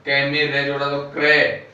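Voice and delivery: ordinary talk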